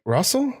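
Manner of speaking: "Russell" lifted high at the end; questioning tone. Retold or imitated. Imitating the scam caller, "Russell" rising high as a question. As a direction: call out "Russell" as an uncertain question. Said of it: "Like they're asking".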